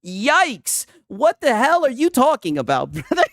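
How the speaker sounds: usual speaking voice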